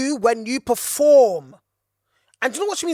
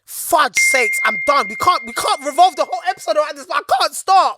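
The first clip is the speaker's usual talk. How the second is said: exasperated outburst; 4 semitones higher, 6 dB louder than usual. Real, not acted. Exasperated at own unstoppable preaching.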